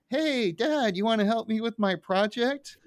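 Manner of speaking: mock-sweet kid daughter; Lisa impression. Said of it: "he's like".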